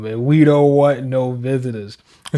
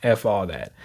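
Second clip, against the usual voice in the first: low mock dismissal; 8 semitones lower, 9 dB quieter than usual. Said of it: "They're like".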